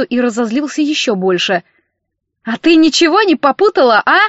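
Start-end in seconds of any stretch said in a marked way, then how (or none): 2.4-4.2 high, loud indignant challenge; heated outburst; yelling. A narrator's reading. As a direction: yell it as a furious accusation. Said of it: "завопил он".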